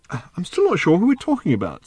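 high-pitched, hesitant confusion; puzzled Arthur. A narrator's reading. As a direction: voice a baffled character, pitched well up. Arthur confused in voiced dialogue.

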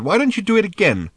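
high, brightly sardonic suggestion; cheered up. A narrator's reading. Arthur cheered by Ford's jump from window, perky. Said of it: "This cheered Arthur up".

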